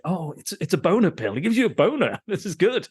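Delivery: thrilled at the pill; mock excited. Host quoting the character about the pill, mock excited. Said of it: "he's like".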